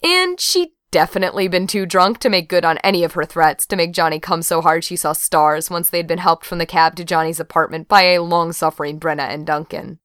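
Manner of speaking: usual speaking voice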